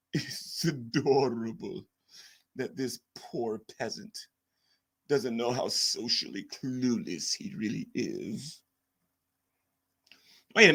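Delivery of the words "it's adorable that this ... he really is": slow, soft, snooty put-on voice; mock him slow and smug; imitating Scientist Sam. Streamer mocks a critic to chat, slow and smug. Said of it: "We gotta imitate him real quick".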